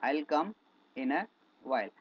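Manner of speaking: "I'll come in a while" said slowly with pauses; teacher models the English line, slowly and clearly; slow model sentence. Shown as a demonstration. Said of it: "आपको English बोलनी पड़ेगी".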